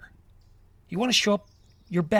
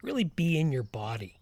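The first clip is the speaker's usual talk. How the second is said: guided meditation. Acted. Leads a walking meditation.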